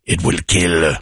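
deep, slow, menacing prophecy; ominous doom voice. Acted. Jokingly naming the baby Demon, in a deep ominous voice. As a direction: intone it like a horror movie omen.

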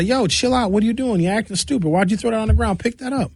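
high, sharp mock scolding; scolding a teenager. Comedian acting out scolding a teen in public, high and sharp. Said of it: "and say".

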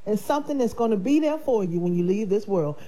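ordinary delivery

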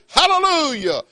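high, ringing praise shout; shouted hallelujah. Shown as a demonstration. Preacher modelling for the congregation, high ringing hallelujah. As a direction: show them how to say it out. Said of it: "I'd say".

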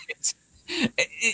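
strained, nearly gone voice; lost voice. Real, not acted. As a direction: voice giving out after shouting. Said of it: "I've lost your voice".

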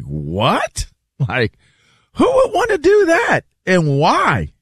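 high-pitched, baffled retold reaction; exaggerated, squeaky-high incredulity; incredulous mock disbelief. Retelling people's baffled disbelief.

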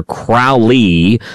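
Crowley said to rhyme with "foully". Shown as a demonstration. Host quoting the song title, OW in Crowley. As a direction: say Crowley with an OW vowel. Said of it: "when I listen to ... the [name] song".

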